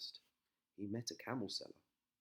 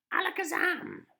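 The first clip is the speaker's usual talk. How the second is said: witch's spell; 12 dB louder than usual. Acted.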